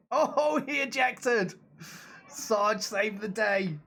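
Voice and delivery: high, elated outburst as the pilot ejects; excited cheer. Real, not acted. Streamer cheering that Sarge saved the day, real excitement.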